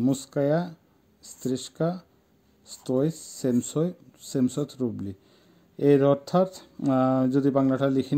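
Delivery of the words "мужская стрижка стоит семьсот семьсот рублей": very slow, softer, word-by-word model; pronunciation demo. Shown as a demonstration. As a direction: leave a gap after each word for learners. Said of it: "উচ্চারণ". (ধীরে ধীরে উচ্চারণ দেখানো)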